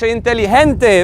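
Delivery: inteligente with the G said like an H; Spanish G as H. Shown as a demonstration. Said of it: "The G is an H".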